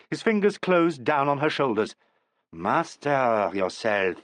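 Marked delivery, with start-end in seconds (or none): 2.5-4.2 slow, low, steady order; calm her with a slow firm command. A narrator's reading. Coach steadies the panicking actress, slow and firm. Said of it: "he said".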